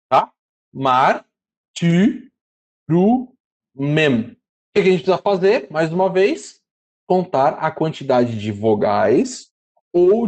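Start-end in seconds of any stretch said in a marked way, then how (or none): 0.7-4.2 Mar, ti, ru, mem split apart with pauses between each syllable. Shown as a demonstration. Teacher separating syllables for students, slow and deliberate. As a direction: tap out each syllable for the class. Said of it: "aqui é ru".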